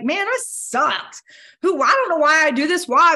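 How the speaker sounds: hammed-up defeated sigh of a bad day; mock despairing self-talk. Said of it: "some days I am like".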